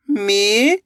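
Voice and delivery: ordinary talk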